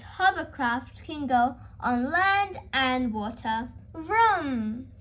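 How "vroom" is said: engine vroom. A narrator's reading. Hovercraft engine noise.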